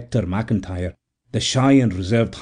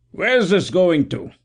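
higher, slow call across the deck; stevedore calling; 8 semitones higher, slower than usual. A narrator's reading. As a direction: call out to the newcomer. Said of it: "called the stevedore".

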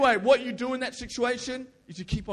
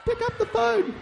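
put-on high, laughing girly voice; hammed-up girly squeak; like a girl; 14 semitones higher than usual. Shown as a demonstration. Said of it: "like a girl".

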